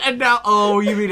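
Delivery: ordinary delivery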